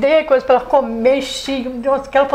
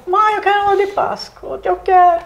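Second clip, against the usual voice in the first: higher-pitched imitation of a child's plea; criança pedindo; 8 semitones higher than usual. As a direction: plead like a kid to mom, retold. Criança pedindo ovo de Páscoa. (imitando criança pidona, voz mais aguda)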